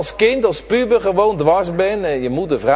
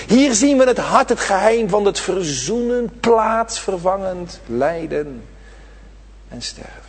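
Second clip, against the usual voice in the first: ordinary talk, nothing marked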